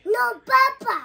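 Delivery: high, loud objection to Papa; loud protest. Real, not acted. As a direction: shout back in protest. Child protests Papa's accusation.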